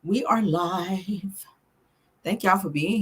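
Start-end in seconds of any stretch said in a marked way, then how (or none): 0.0-1.5 slow hushed whisper of the same words. Shown as a demonstration. Streamer repeating to chat, slow whisper. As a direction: whisper it like a secret, slowly. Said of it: "We're live".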